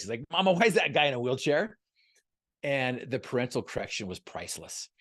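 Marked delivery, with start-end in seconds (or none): 0.3-1.8 put-on child blurting it out louder and higher; mock child's loud question; loud outside voice. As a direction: blurt it like a kid who doesn't know to whisper. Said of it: "in his outside voice".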